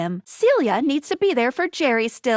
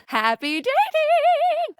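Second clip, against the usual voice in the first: very high, drawn-out cheer; showy sign-off; 13 semitones higher, slower than usual. Acted. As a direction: stretch it out high like a game-show farewell.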